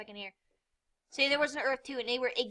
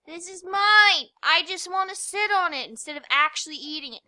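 mock possessive yell; 7 semitones higher, 11 dB louder than usual. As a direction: shout your claim like a greedy player. Claims food in game.

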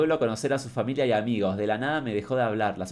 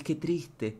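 softer sad sigh; 4 semitones higher, 7 dB quieter than usual. Shown as a demonstration. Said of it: "lo ves y decís".

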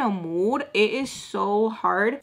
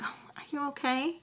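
soft, higher, confiding question; hushed concern; 6 semitones higher, 10 dB quieter than usual. Retold or imitated. Missus Peach checks on her privately, hushed high voice. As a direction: lean in and ask softly.

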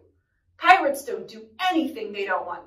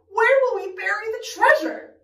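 high, insistent question; hollering; 9 semitones higher than usual. Acted. Captain worries where treasure will go, hollering. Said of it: "hollered Braidbeard".

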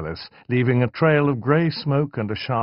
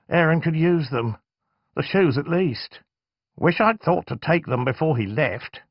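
mother voice; 8 semitones higher than usual. Acted.

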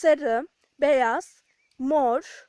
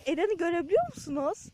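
ordinary talk

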